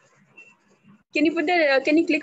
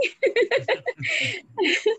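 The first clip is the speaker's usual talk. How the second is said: laugh; 8 semitones higher than usual. Real, not acted. Trainer after mentioning her training to learners, laughing. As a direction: break into a higher-pitched laugh.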